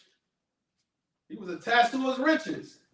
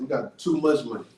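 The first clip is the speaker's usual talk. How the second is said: ordinary delivery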